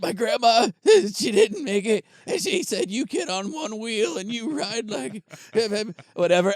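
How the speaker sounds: high, loud hammed-up sob story voice; mock weepy contestant. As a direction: play the teary reality show guy, overdone. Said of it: "They'd be all like".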